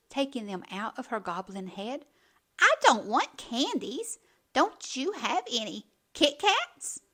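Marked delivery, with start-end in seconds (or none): 2.6-7.0 loud, high spoiled demand; demanding whine. A narrator's reading. Little witch demanding Kit Kats from the neighbor. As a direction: insist like a bratty kid.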